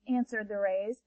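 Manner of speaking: ordinary delivery, nothing marked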